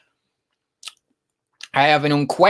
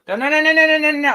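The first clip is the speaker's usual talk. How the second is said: much higher, quick no's at the cat; high pet scolding; 12 semitones higher, 4 dB louder, faster than usual. Real, not acted. Streamer to cat climbing on him, high loud no's.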